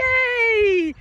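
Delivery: high, loud, long cheer; excited cheer. Real, not acted. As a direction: celebrate with the dog. Cheering a dog.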